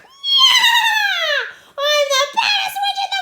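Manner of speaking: high, very loud witchy battle cry; screaming. Acted. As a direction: scream it out like a wild child playing witch. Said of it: "Ramona ran screaming onto".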